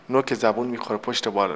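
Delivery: ordinary talk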